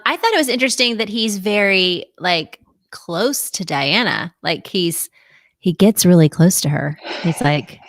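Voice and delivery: usual speaking voice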